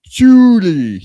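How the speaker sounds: high, loud Irish-accented Shuli. Shown as a demonstration. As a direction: say the name with a mock Irish lilt. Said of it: "I guess I could say 'em both the same way".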